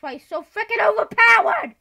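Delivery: yelling in outrage; loud, high exasperated yell; frustrated shout. Real, not acted. Frustrated gamer yelling at mob.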